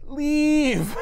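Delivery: high, odd-voiced command; Bruce warning. Bruce inside Hulk ordering Rogue out, strange voice. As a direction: order her out.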